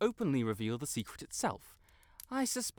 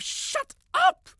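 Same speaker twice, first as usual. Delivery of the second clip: shrieks shut up much higher and louder; furious shout; 8 dB louder than usual. Acted. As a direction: explode at the voice in your head. Snaps at his own hex.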